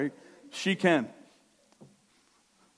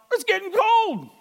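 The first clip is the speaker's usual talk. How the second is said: high mock wife voice, whiny; impatient wife; 12 dB louder than usual. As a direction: squeak it like the hungry wife fretting. Wife's thought at dinner prayer.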